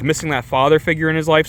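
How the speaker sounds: ordinary delivery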